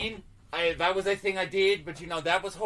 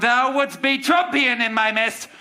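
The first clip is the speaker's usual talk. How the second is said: higher, drawn-out declaimed accusation; theatrical outrage; 7 semitones higher, 7 dB louder, much slower than usual. Acted. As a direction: build to a grand Shakespearean denunciation. Shakespearean accusation reaching its climax.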